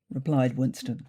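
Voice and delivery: ordinary delivery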